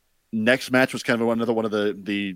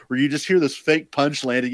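ordinary talk, nothing marked

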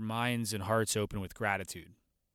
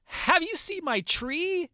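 put-on high, slow, excited kid; boy points out his young tree, in a high, slow child imitation; little boy voice; 5 dB louder, slower than usual. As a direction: imitate a child eager to show more. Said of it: "And he says".

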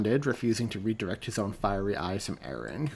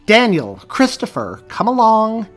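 loud, high call from offstage; teacher calling her pupils from the wings, loud and high; 12 semitones higher, 14 dB louder, slower than usual. Acted. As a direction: call the boys back from a distance.

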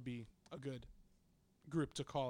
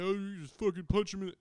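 higher, louder mock know-it-all; mocking listener; 5 semitones higher, 8 dB louder than usual. Mocking the listener who'd say just punch him. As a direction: parody a backseat tough guy. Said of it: "before you're like".

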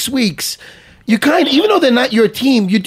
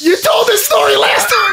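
laughing shout; 10 semitones higher than usual. Real, not acted. Laughing at the friend retelling his story. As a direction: crack up and call him out.

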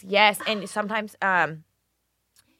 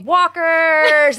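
loud high drawn-out yell; retold yell; 9 semitones higher, 10 dB louder than usual. Retelling husband's sleep-talk outburst to the hosts, loud yell. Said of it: "He yelled".

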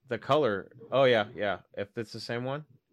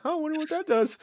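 high curious voice; faster than usual. Retold or imitated. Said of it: "and I'm like".

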